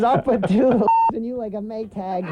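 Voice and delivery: a mock threat from a nasty dummy; George the puppet. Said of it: "the nastiest puppet".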